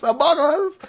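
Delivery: high loud gleeful callout; high excited joke exclamation; excited meme shout. Real, not acted. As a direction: blurt the meme with glee.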